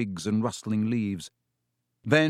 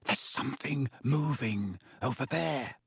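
hushed, breathy near-whisper; soft, tense undertone; breathed; 2 semitones higher, 6 dB quieter than usual. A narrator's reading. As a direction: breathe it softly, wary of being heard. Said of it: "Harry breathed".

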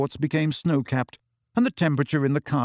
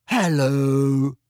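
lightly voiced dreamy girl, drifting and far away; vague dreamy voice; 3 dB louder than usual. A narrator's reading. Luna greeting the nervous friends, vague and dreamy. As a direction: let it float, a little absent. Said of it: "a vague and dreamy voice".

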